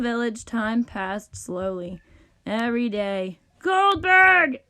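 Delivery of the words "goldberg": high, loud call; demanding shout. A narrator's reading. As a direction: bellow for the servant. Rich dude calls for musician.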